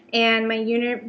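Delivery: ordinary talk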